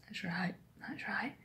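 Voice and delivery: soft unvoiced whisper of agreement. Two old ladies at the far end, whispered agreement. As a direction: murmur it like distant old ladies.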